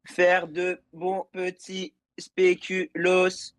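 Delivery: slow, stiff robot voice. Pretend. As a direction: talk like a slow machine. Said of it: "comment je fais le robot".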